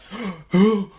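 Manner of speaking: hammed-up high, loud gasp; overacted gasp. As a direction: gasp in mock shock like a bad actor. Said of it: "he's, like, so overacting".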